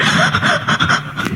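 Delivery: breathy mock sobs in quick gasps; mock weeping, all breath; imitated sobbing. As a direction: imitate someone crying very sadly in voiceless gasps. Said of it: "sedih banget nangisnya".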